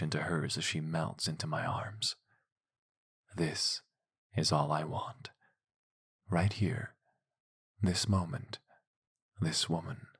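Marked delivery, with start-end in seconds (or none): none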